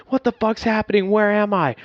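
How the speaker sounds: high, loud confused panic; panicked yelling. Retold or imitated. Veteran waking up sleep drunk, yelling in panic. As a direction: shout it like you just woke up lost. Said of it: "I'm, like, panicked now, too".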